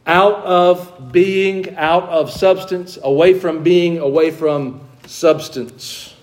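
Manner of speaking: very slow, each phrase weighted on out and away; slow emphatic teaching. Shown as a demonstration. Preacher spelling out the meaning, slow and emphatic. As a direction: lay out each phrase deliberately, stressing out and away. Unpacking the Greek word.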